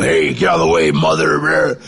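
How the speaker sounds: deep, barked tough-guy growl; mock prison yard threat; growling convict. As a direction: growl it like a con pushing people. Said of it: "Just really growling".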